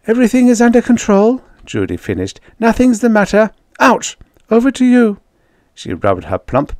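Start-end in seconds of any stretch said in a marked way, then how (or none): 0.1-1.4 high, loud, rushed reassurance; flustered cover-up. A narrator's reading. Judy covering up while being stoned. 2.6-5.2 high, loud voice broken by an ouch; flustered cover-up. A narrator's reading. Judy hit by stone on radio.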